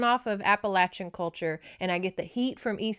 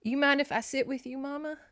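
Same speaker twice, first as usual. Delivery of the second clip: tender daughter voice; 7 semitones higher than usual. Retold or imitated. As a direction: gently ask to sit with your mama. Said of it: "she says, quote".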